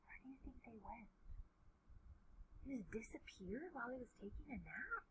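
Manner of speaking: very soft, quick questions to the listeners; near-whispered storyteller's aside; hushed wondering aside. A narrator's reading. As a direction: wonder aloud almost under your breath.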